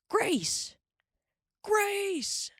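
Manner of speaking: high calling shout. A narrator's reading. Pa shouts across the prairie for Grace, calling. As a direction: shout her name again and again. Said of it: "he shouted".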